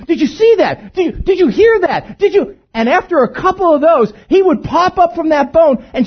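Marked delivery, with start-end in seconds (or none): 0.1-2.5 fluffed up excitement. Retold or imitated. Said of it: "then I'd fluff it up a little more than that".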